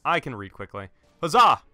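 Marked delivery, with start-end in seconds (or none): 1.2-1.6 loud, higher-pitched triumphant cry; battle cry. Real, not acted. Player cheering himself on mid-match, battle cry. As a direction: call it out like a charge.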